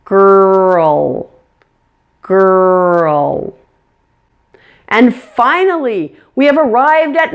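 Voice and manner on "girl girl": put-on very drawn-out "Girl", held er sliding into dark L; two-syllable pronunciation. Shown as a demonstration. Teacher tries it all out for learners, "girl" slowly in two syllables. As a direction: stretch "girl" slowly on a steady note, full syllabic L. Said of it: "let's try it all out here".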